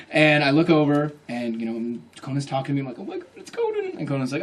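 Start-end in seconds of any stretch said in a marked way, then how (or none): 3.0-3.9 tiny, high-pitched fan squeal; starstruck squeal. Retold or imitated. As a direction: squeal softly to yourself, very high. Starstruck inner squeal at host.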